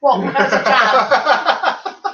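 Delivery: laughing through the question, drawn out. Real, not acted. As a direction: fall into laughter while asking. Joking about honest job holder.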